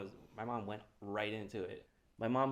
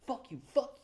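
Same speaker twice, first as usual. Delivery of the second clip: higher, slowing mock yelling back and forth; mock cussing match; 9 semitones higher, 6 dB louder than usual. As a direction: replay the shouting match. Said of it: "we just start cussing".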